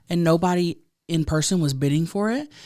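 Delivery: ordinary delivery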